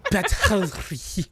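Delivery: Batterie said slowly in a hammed-up French accent; mock French. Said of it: "Whatever the fuck".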